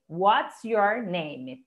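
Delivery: WHAT'S YOUR NAME spaced out very slowly; teacher's slow word-by-word repeat; slow model phrase. Shown as a demonstration. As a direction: say it slowly, each word apart.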